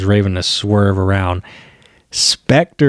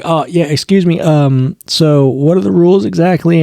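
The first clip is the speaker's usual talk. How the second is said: raised, put-on whiny voice for the rude interrupter; mock annoying interrupter; 6 semitones higher than usual. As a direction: play the smug guy cutting in, voice pitched up. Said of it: "And he's like".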